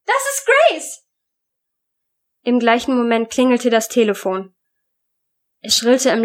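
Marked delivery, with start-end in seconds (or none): 0.0-1.0 high-pitched, horrified cry; aghast mother's shriek; horrified scream. A narrator's reading. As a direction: cry out in shock, voice leaping way up. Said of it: "schrie die Mutter entsetzt".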